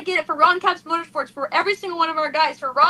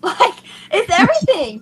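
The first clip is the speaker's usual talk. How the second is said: half laugh, half cry, higher pitched; laughing through tears; 5 semitones higher than usual. Real, not acted. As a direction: laugh it out while tears come. Emotional winner laughing through tears.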